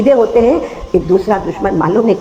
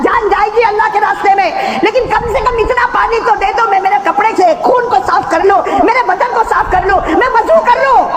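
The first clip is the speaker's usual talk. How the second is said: preaching at full force; 14 semitones higher than usual. Acted. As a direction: cry it out to the crowd.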